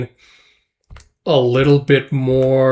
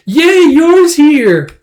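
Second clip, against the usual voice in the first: gushing delight; 14 semitones higher, 9 dB louder, much slower than usual. Retold or imitated. Yuri thrilled his sister arrives.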